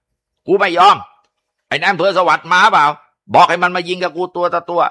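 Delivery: high-pitched outlaw's yell; defiant shout. A narrator's reading. Cornered bandit refusing to surrender to police, shouting defiantly.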